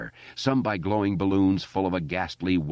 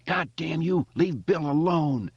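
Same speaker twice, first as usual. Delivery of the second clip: shriek; 10 semitones higher than usual. Acted. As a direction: shriek it at the monster. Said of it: "he shrieked".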